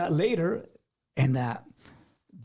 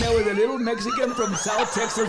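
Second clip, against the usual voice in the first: louder, laughing through the words; laughing punchline; 4 semitones higher, 5 dB louder than usual. Real, not acted. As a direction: laugh it out at your own expense. Self-deprecating punchline of story.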